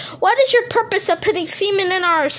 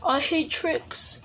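much lower, very soft, slow; low murmur; 6 semitones lower, 10 dB quieter, slower than usual. Acted. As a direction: mutter it under your breath. Repeated line.

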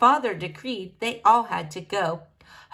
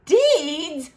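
higher, drawn-out exclamation; incredulous cry; 7 semitones higher, 4 dB louder than usual. A narrator's reading. King throws his daughter's word back, crying out. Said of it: "cried the king".

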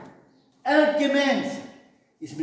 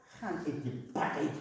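a lightly voiced God, low, soft and quick; 7 semitones lower, 12 dB quieter, faster than usual. Preacher voicing God turning from the hypocrite, low and soft. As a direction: let God speak quietly, lower than the preacher. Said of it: "dan zegt God".

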